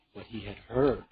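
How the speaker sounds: usual speaking voice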